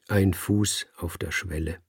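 ordinary delivery, nothing marked

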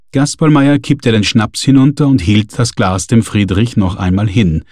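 ordinary delivery

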